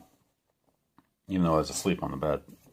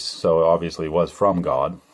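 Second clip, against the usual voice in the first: ordinary talk, nothing marked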